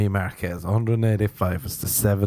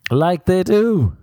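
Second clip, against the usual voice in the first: sarcastic squeak; 10 semitones higher, 5 dB louder, slower than usual. Pretend. Sarcastic high comment.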